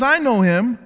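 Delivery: loud, high mock boast of faith; mock professing believer. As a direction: play the proud fake believer. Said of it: "The man who says".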